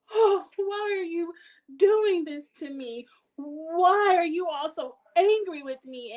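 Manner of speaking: high, slow, whiny fake sobbing; hammed-up victim tears, pleading; mock crying Karen. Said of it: "there go the Karen tears".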